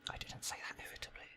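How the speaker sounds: real whisper, very soft; whispered aside. Real, not acted. Reader critiquing own delivery, whispered aside. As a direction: drop to a whisper, as if to yourself.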